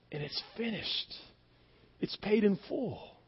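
very soft, sinking to a whisper; hushed finality. Acted. Preacher ending Christ's words from the cross, hushed. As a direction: let each short phrase fade away.

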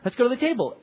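upbeat mealtime summons; cheerful call to the table. Shown as a demonstration. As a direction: call the family in brightly. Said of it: "you say".

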